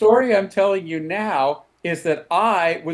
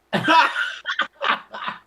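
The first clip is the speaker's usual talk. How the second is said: high burst of laughter; real laugh; 10 semitones higher than usual. Real, not acted. Listener laughs at the punchline.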